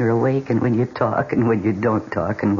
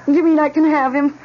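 high, loud thrilled disbelief; 14 semitones higher, 3 dB louder than usual. Acted. Wife learning she can keep the dog, high and thrilled. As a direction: bubble over at the news.

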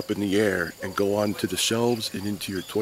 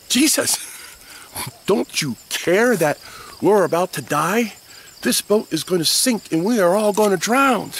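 panicked plea; 8 semitones higher, 3 dB louder than usual. Acted. Frightened disciples wake Jesus.

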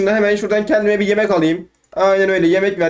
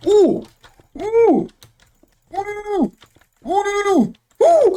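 high startled oohs; startled hoots; 13 semitones higher, much slower than usual. Real, not acted. Gamer escaping a fight, high startled hoots. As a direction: yelp in surprise up high.

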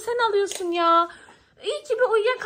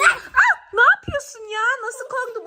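loud, jolted protest; startled outcry; 3 semitones higher, 5 dB louder than usual. Acted. Girl startled when Ayşe Reva suddenly wakes, mock fright. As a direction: yelp as if caught off guard.

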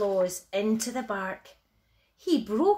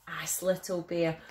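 plain reading voice

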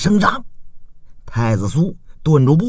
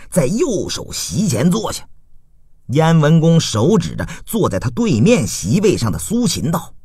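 plain reading voice

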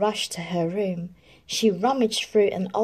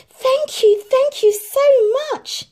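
very high, loud, overjoyed; joyful thanks; 10 dB louder, slower than usual. A narrator's reading. Cinderella thanks her friends, very high joyful. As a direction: gush with gratitude. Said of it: "she exclaimed to her friends".

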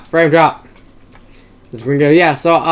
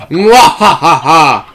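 evil laugh; 3 dB louder, much slower than usual. Pretend. Villain laugh while playing.

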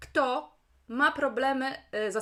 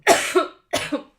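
hard loud cough; real coughing fit; chesty cough; 6 semitones higher, 13 dB louder than usual. Real, not acted. Sick streamer coughing.

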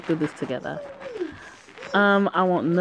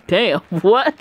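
loud laugh, high and incredulous; laughing disbelief; 5 semitones higher, 6 dB louder than usual. Real, not acted. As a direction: crack up at it. Reader laughs at the story.

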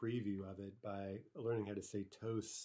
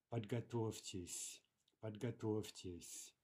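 подготовьтесь said slowly, each syllable spelled out clearly; two slow repeats for the students to copy; slow model repeat; slower than usual. Shown as a demonstration. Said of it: "teacher models the form slowly".

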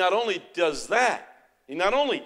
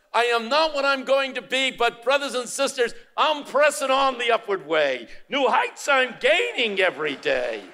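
preaching at full force; 7 semitones higher, 6 dB louder than usual. Real, not acted.

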